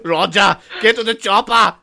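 hammed-up Austrian action-hero shout; Arnold Schwarzenegger. Arnold to Roger in a buddy film, mock urgent shout. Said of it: "Arnold Schwarzenegger and Roger Moore".

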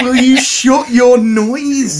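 slow, laughing repeat of the line; amused echo. Retold or imitated. Host echoing the son's line, slow and amused. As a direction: savour the phrase while laughing. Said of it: "Oh my God".

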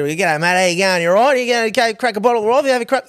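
put-on broad Aussie accent. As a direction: play the broad outback Australian bloke for laughs. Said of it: "the accents that are".

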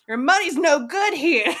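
imitated hallway yell, breaking into a laugh. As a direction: call it out like a delivery man yelling down a hallway. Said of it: "yelled down the hall".

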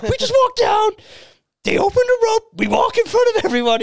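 very high, loud mimicked squeal; overexcited man-child. Storyteller voicing his friend let into the front, squealing. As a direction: imitate a friend bursting with giddy disbelief.

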